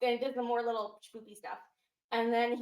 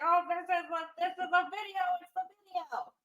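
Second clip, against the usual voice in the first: high, excited discovery; mock excited realization, pitched up; excited quote; 7 semitones higher, 3 dB louder than usual. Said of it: "and he's like".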